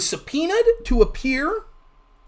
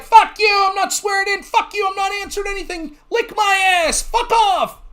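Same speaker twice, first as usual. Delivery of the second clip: hammed-up drunk brat telling them off; drunk Hunter; 9 semitones higher than usual. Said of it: "be like".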